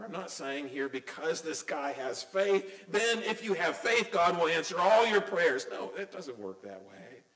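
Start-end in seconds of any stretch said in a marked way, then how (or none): none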